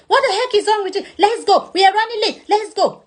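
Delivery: impatient friend. Retold or imitated. Friend voiced nagging about lateness.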